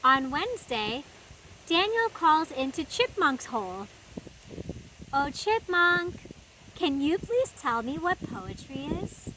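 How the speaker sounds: put-on lively read-aloud for little kids; storybook reading. A narrator's reading. Host reading a picture book to children, animated storytime voice. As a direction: read it like story time in the garden.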